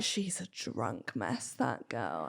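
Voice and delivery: slow, low, quiet mock sneer. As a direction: drag it out low and snide. Mocking commenters' judgement.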